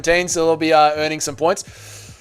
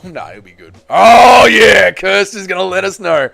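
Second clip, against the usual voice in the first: usual speaking voice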